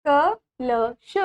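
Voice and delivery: each syllable of कलश drawn out very slowly. Shown as a demonstration. Teacher sounding out कलश slowly for the children. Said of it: "Again पढ़ेंगे ma'am के साथ".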